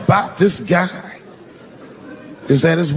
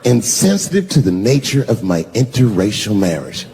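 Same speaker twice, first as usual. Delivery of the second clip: quoting her; 7 semitones lower than usual. Retold or imitated. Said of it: "I'm quoting her".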